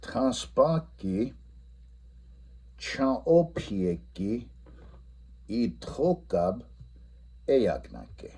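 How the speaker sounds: each Lakota word slowly stretched; modelling the Lakota answer slowly; slow model answer. Shown as a demonstration. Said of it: "the answer should be".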